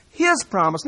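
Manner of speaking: "his" hit hard and loud, then "promise" falls away; stress on his. Shown as a demonstration. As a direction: lean hard on "his" to show it is God's. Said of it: "I emphasize certain words".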